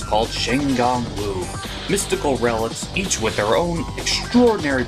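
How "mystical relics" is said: ordinary delivery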